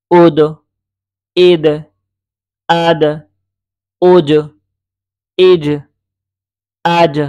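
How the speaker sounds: "উদ" through "আজ" loud and separate, endings bounced; reverse qalqalah drill. Shown as a demonstration. Teacher drilling from end to start, "ইজ" with bounced release. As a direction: punch each syllable, echo the d of "আদ" and j of "আজ". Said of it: "শেষ থেকে আবার শুরুর দিকে যাবেন".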